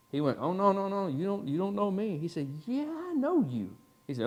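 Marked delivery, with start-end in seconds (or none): 0.4-2.2 higher-pitched, softer protest; mock denial. Suspect denies knowing the officer. 2.7-3.8 high, slow, drawn-out recognition, softer; knowing police officer. Retold or imitated. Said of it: "giving him that police officer look".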